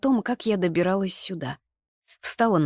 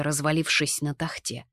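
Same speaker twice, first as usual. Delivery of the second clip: usual speaking voice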